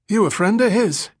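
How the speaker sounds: very high, suspicious derelict; Billy Collins. A narrator's reading. Ragged man asking if the stranger is Tommy's friend, high and suspicious. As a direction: challenge him in a high, addled voice.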